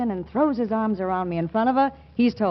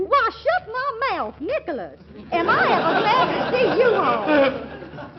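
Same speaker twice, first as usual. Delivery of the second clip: high, slow syrupy Southern belle; 10 semitones higher, slower than usual. Acted. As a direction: gush like a Virginia sweetheart. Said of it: "Little old Ellen Calhoun".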